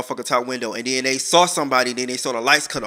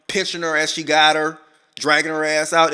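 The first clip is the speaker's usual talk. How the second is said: ordinary talk, nothing marked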